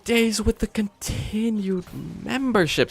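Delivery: high, slow put-on announcer voice; announcer read. Streamer reading a membership alert in a high, slow voice.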